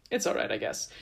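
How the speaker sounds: unimpressed God, almost whispered; a breathy, shrugging "it's all right"; dismissive meh. Retold or imitated. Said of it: "is like".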